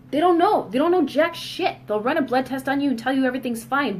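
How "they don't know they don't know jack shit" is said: higher and louder, fed up with doctors; venting about doctors who can't help, exasperated and raised; frustrated outburst. Real, not acted. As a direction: spit it out in exasperation.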